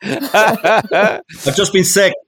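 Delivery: high, giggling, very slow; laughing reply. Pretend. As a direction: play the weary booth actor through laughter. Actor replies in skit, laughing.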